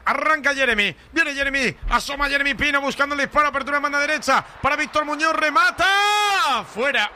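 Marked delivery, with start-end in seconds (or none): 5.3-7.1 stretched, rising shout on the shot; excited shout. Real, not acted. Shot on goal in commentary.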